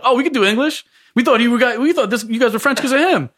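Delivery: lightly voiced surprised waiter; chirpy high quoted waiter.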